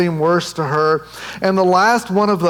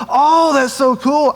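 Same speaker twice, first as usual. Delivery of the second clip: higher and slow, overdone wow; fake enthusiasm; 8 semitones higher, slower than usual. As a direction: pretend to be impressed by an ultrasound you can't read. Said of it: "and I would go".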